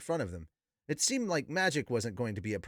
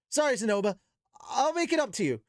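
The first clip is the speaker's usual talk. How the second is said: higher, louder flustered apology; a boy caught breaking something; sheepish Rudeus; 9 semitones higher, 4 dB louder than usual. Acted. Rudeus apologizing to Zenoba, sheepish and high.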